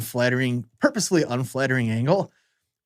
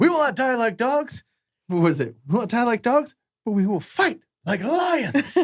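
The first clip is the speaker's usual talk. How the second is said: heroic battle cry; 10 semitones higher than usual. Retold or imitated. Amigo answering the bandits, high mock-heroic declaration. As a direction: puff up like a hero refusing to yield. Heroes' rallying line retold.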